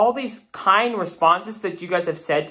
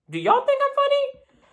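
teasing question. Acted.

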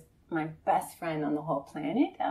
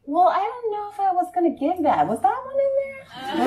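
high, loud, hesitant take-back; clingy friend; 13 semitones higher, 6 dB louder than usual. Retold or imitated. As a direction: pretend to want the clothes back, voice up high.

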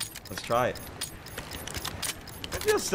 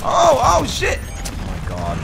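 startled high yell, then drawn out; shocked shout; 11 semitones higher, 6 dB louder, much slower than usual. Real, not acted. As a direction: panic outburst. Spotted enemy, shocked.

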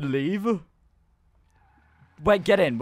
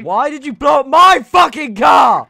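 yelling furiously, laughing; angry shout; 6 semitones higher, 13 dB louder than usual. Real, not acted. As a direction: bellow it in outrage. Car blown up by friend.

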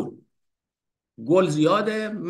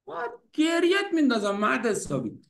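higher, scolding wife voice; mock scolding wife; 9 semitones higher than usual. Wife scolding Hafez, playfully higher scolding voice.

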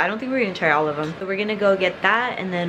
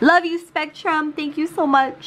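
sarcastic sweet voice; 8 semitones higher than usual. Acted. Sarcastic thanks to the internet provider.

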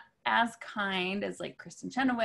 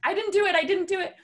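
much higher and louder pleading; mock frightened denial; 10 semitones higher, 5 dB louder, faster than usual. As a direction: whimper your innocence like a kid. Hiding from the artist.

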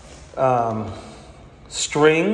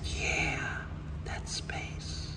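hushed retold answer; whisper; 15 dB quieter, much slower than usual. Hanger whispering to the designer to confirm the spot, hushed. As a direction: whisper it softly.

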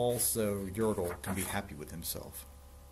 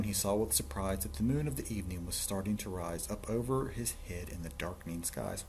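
ordinary delivery, nothing marked

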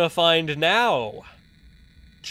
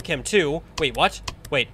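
ordinary delivery

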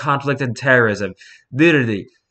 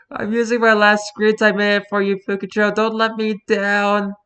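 put-on high, sweet childlike plea; cutesy fan voice; 9 semitones higher than usual. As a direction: plead with big puppy eyes. Reading a fan's plea.

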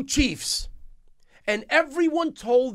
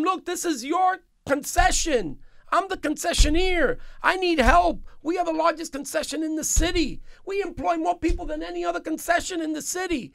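high-pitched, insistent appeal for help; urgent retold plea; 7 semitones higher than usual. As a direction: plead your case to the mayor, pitched up.

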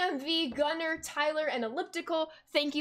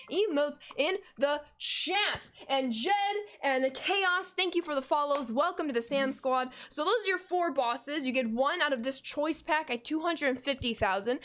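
usual speaking voice

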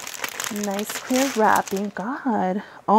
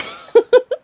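loud, high giggle; excited laugh; 13 semitones higher, 6 dB louder than usual. Real, not acted.

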